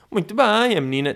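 high-pitched patronising waiter; mock waiter praise. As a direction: beam it out like a jokey waiter. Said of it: "a fazer aquele humor".

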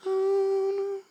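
high, hammed-up oh no; mock whiny refusal. Preacher playing Todd dodging the phone request, high mock whine. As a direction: squeak it out like a nervous friend.

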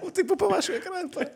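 high laughing through the words; nervous laughter. Real, not acted. Teller laughs at having been scared by his phone, high laughter. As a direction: laugh off your own fright. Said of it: "estou com tanto medo".

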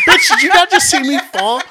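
high, loud and laughing through the words; laughing outburst. Retold or imitated. She laughs at her friend almost slipping too. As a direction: crack up while calling out your friend.

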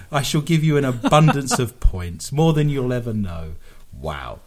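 usual speaking voice

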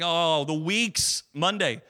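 drawn-out, exaggerated slog, last word long and loud; dragging weary complaint. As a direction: groan it out, stretching the big words. Acting out dragging work weeks.

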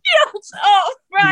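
a sudden high, loud yelp of glee; excited yelp. Real, not acted. Caught out about his accent, yelping with glee. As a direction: jump in with a delighted high shout.

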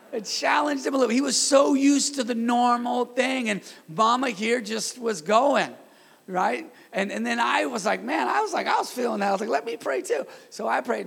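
loud, high, rousing sermon delivery; animated pulpit storytelling, pushed up and out; fired-up preaching. Real, not acted. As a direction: preach it at full force, stretching the big phrases.

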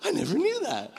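high-pitched mock amazement; amazed listener. Preacher mimics listeners learning what fortnight means, high amazement. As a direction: jump up high as if it just dawned on you. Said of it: "Some of you are like".

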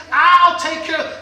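slow, loud, firm; loud vow. Acted. Jezebel to her husband, a loud slow vow. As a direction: promise it with force.

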